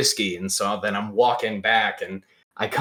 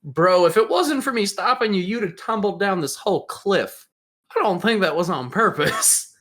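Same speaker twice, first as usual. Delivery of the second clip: higher-pitched incredulous retort; retold disbelief; 8 semitones higher than usual. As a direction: push back on the excuse, re-enacted.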